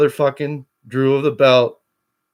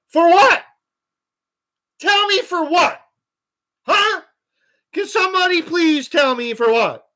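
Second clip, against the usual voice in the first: furious rant; 13 semitones higher than usual. Real, not acted. Streamer demanding of chat why he spends money, furious. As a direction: yell at the chat in real fury.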